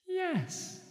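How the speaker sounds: high lilting falsetto; haunted falsetto. A narrator's reading. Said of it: "lilting and haunted falsetto".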